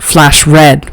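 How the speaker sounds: ordinary delivery, nothing marked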